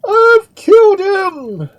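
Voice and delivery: piercingly high, slow, frantic cry; loud shriek. A narrator's reading. Duke answering all questions with a shriek. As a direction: shriek it at full force. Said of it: "shrieked the Duke".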